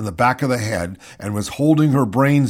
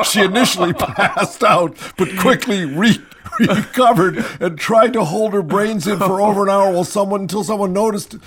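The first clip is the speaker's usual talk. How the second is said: higher-pitched, cracking up mid-sentence; laughing while reading; 7 semitones higher than usual. Real, not acted. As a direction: struggle to read through real laughter.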